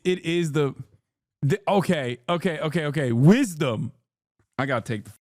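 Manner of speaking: normal talking voice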